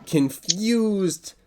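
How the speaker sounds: high, loud, slow mock delight; confused happiness. GM describing patrons' relief, high and drawn-out. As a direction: stretch it out in baffled cheer.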